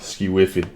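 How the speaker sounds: "whiffy" ending in a clear ee sound; playful "Ski whiffy" ending in ee; funny mispronunciation. Shown as a demonstration. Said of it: "Ski whiffer".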